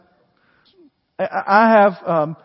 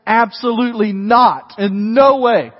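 absolutely not, slow and louder; slow, louder, emphatic absolutely not; emphatic denial; 3 semitones higher, 6 dB louder, slower than usual. Acted. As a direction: lean hard on every word. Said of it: "an emphatic".

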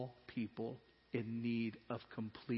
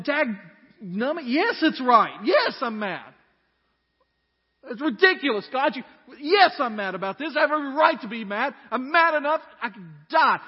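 put-on loud, high, ranting good old boy; angry Southern boy; 12 semitones higher, 16 dB louder than usual. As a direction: Jonah griping at God like a riled-up country boy. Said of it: "I think of Jonah as a good old Southern boy".

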